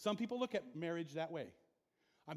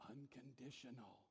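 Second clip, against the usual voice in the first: soft key word; 6 semitones lower, 16 dB quieter than usual. Acted. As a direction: let the word sink in softly. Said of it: "key word answered softly".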